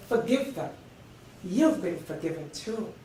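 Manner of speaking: hushed, intimate counsel; gentle reminder. Acted. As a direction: say it tenderly and quietly. Gentle repeat of the plea.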